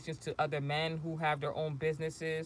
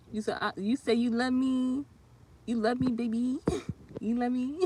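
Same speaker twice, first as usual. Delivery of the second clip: put-on higher, playful "you love me, baby"; teasing sing-song; 7 semitones higher, 4 dB louder than usual. Teasing a fan commenter, higher playful coo. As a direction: coo it back at them with a grin.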